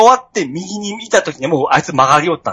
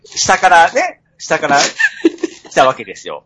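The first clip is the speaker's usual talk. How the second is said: ordinary talk, nothing marked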